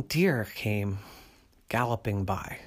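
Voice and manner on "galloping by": plain reading voice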